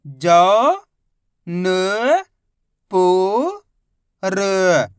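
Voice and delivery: each letter held long and loud, a pause after each; syllable by syllable. Shown as a demonstration. Teacher showing learners each letter's sound, syllable by syllable. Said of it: "एक अक्षर का उच्चारण किया जाता है".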